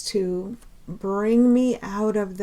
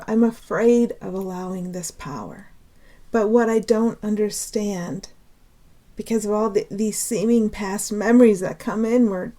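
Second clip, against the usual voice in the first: ordinary talk, nothing marked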